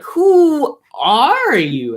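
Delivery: put-on high, slow old man. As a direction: draw it out like an old king. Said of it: "and then Nestor's like".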